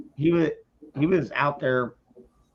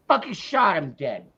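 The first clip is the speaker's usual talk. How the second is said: ordinary talk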